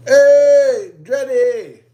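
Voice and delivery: high, drawn-out "hey"; sudden hyped shout-out; loud call-out. Pretend. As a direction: holler it long and high. Sudden rapper-style call-out after whisper.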